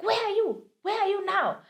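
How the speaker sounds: high, loud demanding yell; shouting landlord. Retold or imitated. Landlord yelling at hiding tenant, high loud demand. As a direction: demand to know where he is. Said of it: "She's like".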